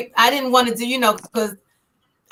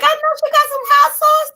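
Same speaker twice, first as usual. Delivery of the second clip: much higher, eager sing-song; hopeful squeal; 3 dB louder than usual. Retold or imitated. Guest hoping for hot sauce, high eager squeal. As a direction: bounce it up high with hope.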